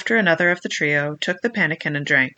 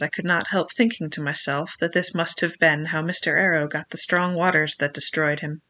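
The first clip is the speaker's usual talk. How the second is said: ordinary delivery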